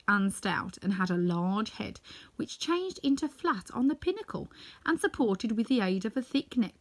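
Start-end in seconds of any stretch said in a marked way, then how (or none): none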